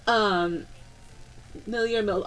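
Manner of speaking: ordinary delivery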